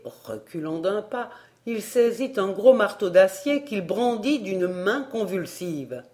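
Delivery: usual speaking voice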